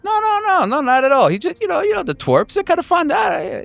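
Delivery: very high, placating denial; hammed-up backpedaling. Man denying bullying to a marshal, high placating voice.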